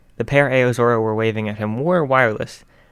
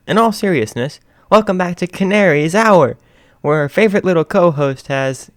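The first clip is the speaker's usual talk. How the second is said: higher, louder host patter; radio host; 8 semitones higher, 8 dB louder than usual. Acted.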